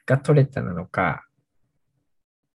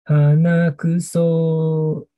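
drawn out; 5 semitones higher, much slower than usual. Acted. Drawn-out punchline answer.

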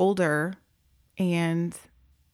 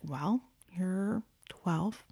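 slow, much softer whisper; hushed whisper; 8 dB quieter, much slower than usual. Retold or imitated. Worker answering the pregnant girl, slow whisper. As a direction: lean in and whisper it, very slowly. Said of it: "And I'm like".

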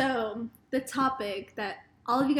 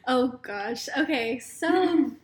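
normal talking voice

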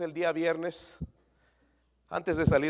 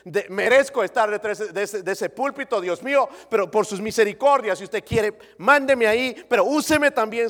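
loud earnest plea to the Lord; spoken prayer; 5 semitones higher, 10 dB louder than usual. Pretend. Pastor showing how he confesses before God, loud pleading prayer. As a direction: pray it out, pleading. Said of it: "tengo que ir delante de Dios".

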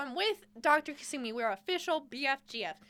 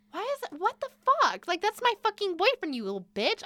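angry outburst; 8 semitones higher than usual. Retold or imitated. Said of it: "I'm like".

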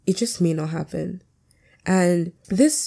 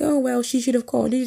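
higher-pitched mock grumble; mock complaining; 6 semitones higher than usual. Mimicking someone who blames the friend for not calling, mock complaining. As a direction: imitate a whiny excuse-maker lightly.

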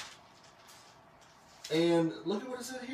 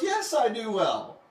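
loud, raised, slow insistence; defiant retort; 5 semitones higher, 6 dB louder, slower than usual. Retold or imitated. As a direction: answer back stubbornly, drawing it out. Jonah talking back to God.

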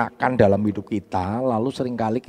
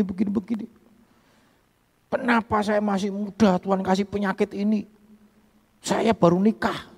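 higher mock outburst; imitating angry rant; 10 semitones higher than usual. Sick young man raging at God, higher mock anger. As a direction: replay the young man's rage at God. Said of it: "Wah, marah-marah".

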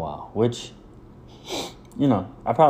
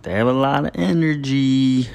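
mock know-it-all; 6 semitones higher, 5 dB louder than usual. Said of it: "they're like".

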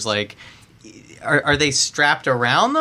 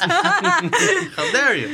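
high, slow mock outrage, laughing; much slower than usual. Host teasing guest about the accent, mock-outraged laugh. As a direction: gasp it out like a scandalized friend. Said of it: "butcher his accent".

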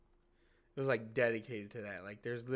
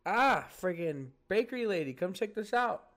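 higher, louder call to a coworker; excited call; 6 semitones higher, 5 dB louder than usual. Retold or imitated. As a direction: call her over to look. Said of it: "and I was like".

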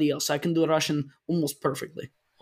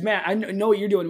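ordinary talk, nothing marked

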